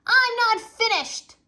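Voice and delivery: high, loud and slow insistence; loud child protest. A narrator's reading. Fudge yelling at his brother that he is not done. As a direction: drag it out, refusing to stop. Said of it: "Fudge shouted".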